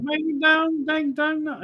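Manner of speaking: garbled high-pitched parody; exaggerated high mock-rally voice; mocking politician impression. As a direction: mimic the speech in a silly high voice. Mocking the clip's speech.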